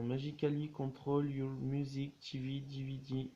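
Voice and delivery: English slogan in a French accent. Real, not acted. French vlogger reading wand packaging in English, French accent. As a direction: read English with French vowels and rhythm. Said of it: "pardon pour mon accent".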